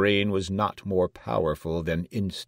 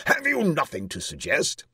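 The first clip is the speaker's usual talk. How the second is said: raised, impatient royal question; 9 semitones higher, 4 dB louder than usual. A narrator's reading. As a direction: demand an idea impatiently. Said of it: "said the king impatiently".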